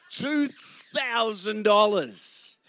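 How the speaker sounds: laughing in disbelief, drawn out; amazed laughing. Real, not acted. Pastor laughing in disbelief at son's sale price. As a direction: let the amazement spill into laughter.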